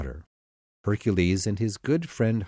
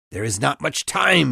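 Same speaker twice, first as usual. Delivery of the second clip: raised, urgent warning call; yelling; 4 semitones higher, 5 dB louder than usual. A narrator's reading. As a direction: call out loudly that time is short. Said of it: "he yelled".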